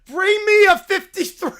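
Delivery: booming demand with a laugh; mock insistent shout. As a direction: hammer the demand home. Repeats demand louder laughing.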